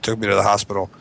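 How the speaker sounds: ordinary delivery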